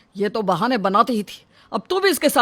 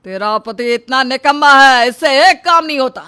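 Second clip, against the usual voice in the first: higher, louder furious yell; angry shout; 4 semitones higher, 7 dB louder than usual. Acted. Father-in-law yelling at the daughter-in-law about her useless husband, angry shout. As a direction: shout him down in rage.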